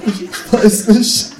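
high, loud, drawn-out cry; anguished wail. A narrator's reading. Narrator cracking under wordplay torture, anguished wail. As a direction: wail slowly in comic agony.

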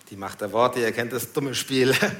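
usual speaking voice

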